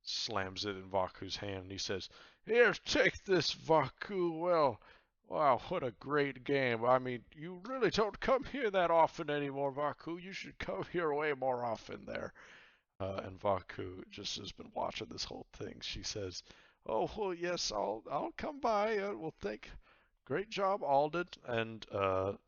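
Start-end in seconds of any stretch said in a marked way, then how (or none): 2.5-12.3 high, loud, effusive voice; hearty captain. Acted. Captain heartily hands over prize. 16.9-21.3 high-pitched, lightly voiced woman; raised-pitch courteous reply; polite female character. Game master voices Vaku, a woman.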